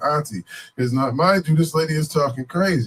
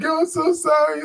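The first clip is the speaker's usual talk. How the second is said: mock high voice of the lady; 11 semitones higher than usual. Crackhead lady's apology voiced.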